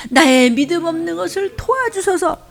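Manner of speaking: high pleading cry; pleading prayer. Shown as a demonstration. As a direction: lift the plea up high. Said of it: "한번 시작".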